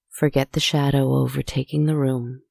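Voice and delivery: plain reading voice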